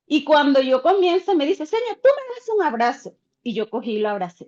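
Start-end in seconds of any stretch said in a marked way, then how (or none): 1.7-3.2 put-on much higher, childlike plea; voicing the boy. Teacher voicing the boy asking her for a hug, high and pleading. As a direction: ask for a hug like a small child.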